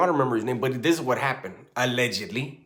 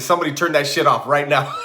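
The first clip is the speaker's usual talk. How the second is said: louder frightened demand under the blanket; scared yell; 4 semitones higher, 8 dB louder than usual. Retold or imitated. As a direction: bark it out loud in fear. Scared kid yelling at ghost.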